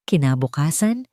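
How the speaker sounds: plain reading voice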